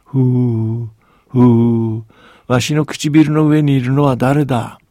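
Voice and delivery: a huge voice breathing out of a cave, slow on the last word; booming giant voice. A narrator's reading. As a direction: blow out each ふー like wind, then ask who it is. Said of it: "声のようなものに変わり始めました".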